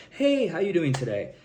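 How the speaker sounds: seeking rapport. Retold or imitated. Coach mimicking people in videos, high-pitched rapport-seeking. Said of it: "have these seeking rapport".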